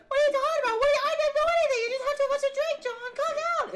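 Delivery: very high, loud, fast mock shrieking; neighbor's screaming wife. Wife fighting back at drunk husband, shrieking. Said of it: "And the wife is like".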